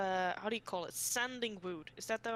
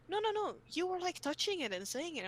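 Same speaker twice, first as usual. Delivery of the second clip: put-on girl's high insistent voice; 10 semitones higher than usual. Classmate insisting.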